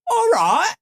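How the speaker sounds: high, sing-song Cockney "All right"; mock Cockney masseuse greeting; Cockney accent. Imitating the Cockney masseuse greeting him, high Cockney. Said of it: "Cockney twang to her voice".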